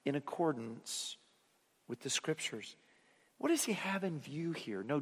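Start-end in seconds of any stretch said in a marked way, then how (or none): 0.0-2.8 emphatic hushed repeat. Shown as a demonstration. Preacher repeating Paul's phrase to the congregation, hushed for emphasis. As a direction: drop the voice to drive it home. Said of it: "And for emphasis, he says a second time".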